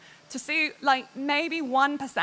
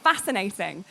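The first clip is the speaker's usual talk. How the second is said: enthusiastic; 6 semitones higher than usual. Shown as a demonstration. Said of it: "my voice is a lot more".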